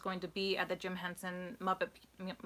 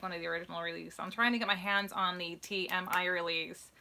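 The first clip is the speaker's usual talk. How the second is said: usual speaking voice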